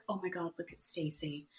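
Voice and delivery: soft, quick, tender imitation; hushed, retold fondness; sweet. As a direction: coo it quietly, as if touched. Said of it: "she was so sweet".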